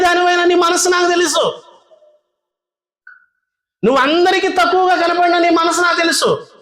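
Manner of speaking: tender reassurance. Acted. King comforts Shulamite.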